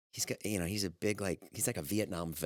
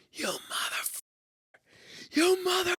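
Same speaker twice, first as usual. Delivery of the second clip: a mock outburst from a furious man; imitated rage. The cameraman turns on the receptionist in mock fury.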